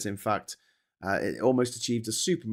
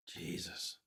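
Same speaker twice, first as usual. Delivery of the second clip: exasperated whisper; 6 semitones lower, 19 dB quieter than usual. Real, not acted. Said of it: "exasperated aside".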